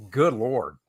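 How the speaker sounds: ordinary talk, nothing marked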